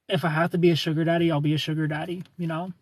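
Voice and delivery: ordinary talk, nothing marked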